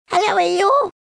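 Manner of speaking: very high little squeak. Acted. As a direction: squeak it like a tiny joey. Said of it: "he squeaked".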